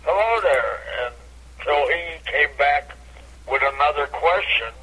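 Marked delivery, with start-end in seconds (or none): 0.0-0.7 repeated catchphrase greeting. Retold or imitated. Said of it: "I kept saying".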